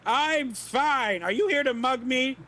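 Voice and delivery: high, loud suspicious granny; cranky old woman. Acted. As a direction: snap like a paranoid old lady. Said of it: "look like an old woman".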